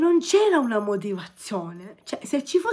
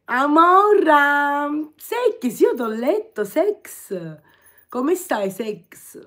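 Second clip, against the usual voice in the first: drawn-out, loud "Amora", then higher; hammed-up flirty call; 5 semitones higher, 7 dB louder, much slower than usual. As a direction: tease back like a diva. Reads flirty chat comment aloud. (richiamo civettuolo esagerato, lento e forte)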